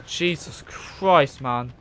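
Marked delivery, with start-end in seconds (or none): none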